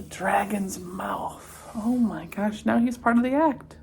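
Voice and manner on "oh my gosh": ordinary delivery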